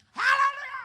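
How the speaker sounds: very high, loud burst; loud high mock-startled hallelujah; startled hallelujah shout. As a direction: yelp it out in shock. Startled, throws iPad.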